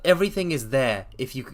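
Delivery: usual speaking voice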